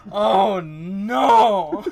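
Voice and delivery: very loud, high wail; loud panicked wail. Retold or imitated. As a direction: scream it in panic. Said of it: "he's just saying".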